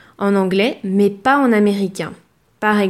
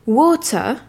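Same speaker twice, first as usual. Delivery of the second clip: British water; "water" with a round o, a crisp T and no final R, "woh-tuh"; British English; 4 semitones higher than usual. Shown as a demonstration. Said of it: "L'eau se dira". (en anglais britannique, "water" avec un o rond, un T net, sans R final)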